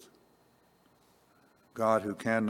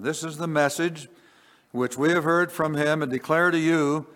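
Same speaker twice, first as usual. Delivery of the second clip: raised reading of the verse; scripture reading; 5 semitones higher, 4 dB louder than usual. Acted. Preacher reading First John to congregation, raised. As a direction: read it out as holy words.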